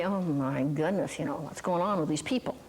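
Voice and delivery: low-pitched, head-shaking disbelief; mock dismay. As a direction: imitate doctors despairing of these people. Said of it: "they shake their heads and say".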